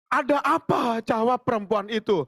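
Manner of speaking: high, slow, lifted Bible reading voice; scripture reading. Acted. Pastor reading the verse to the congregation, high lifted reading.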